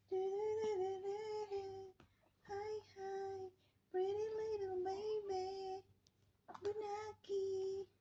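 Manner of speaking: high, sing-song cooing. Real, not acted. Cooing to the baby. (mataas na boses na panlambing sa sanggol)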